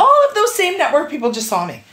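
pressing the point, fed up; exasperated. Real, not acted. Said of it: "exasperated retelling".

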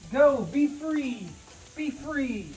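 high, falling calls to a released pet; gleeful send-off. Real, not acted. Releasing iguana into new enclosure.

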